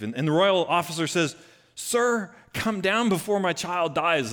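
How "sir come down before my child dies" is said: desperate plea. Acted. Desperate father begs Jesus.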